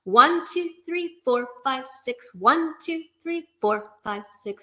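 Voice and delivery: "one" lifted, falling away through "six"; counting in time. Shown as a demonstration. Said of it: "try the first two measures together".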